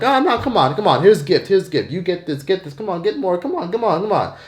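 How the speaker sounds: coaxing fruit seller piling extras in; pushy vendor. Retold or imitated. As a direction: press the gifts on him, high and eager. Vendor pushes free gifts.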